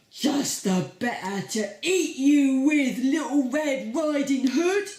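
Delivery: a loud wolf ready to gobble; hungry wolf. Acted. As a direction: boom it out, about to pounce. Wolf voiced in the story.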